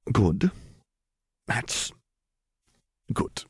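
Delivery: dazed, halting. A narrator's reading. Dazed, nauseous man struggles to speak.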